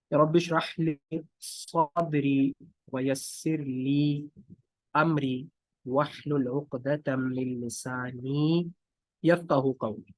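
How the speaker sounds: slow, measured Arabic recitation; dua recitation. Shown as a demonstration. Teacher reciting his lecture-opening dua, slow recitation. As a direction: recite the prayer with long, held vowels. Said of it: "ये दुआ मैं पढ़ता हूँ".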